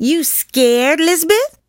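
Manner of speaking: put-on high, rising childish taunt; taunting dare. A narrator's reading. Kid daring a hesitant playmate, taunting. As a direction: needle a friend with a dare.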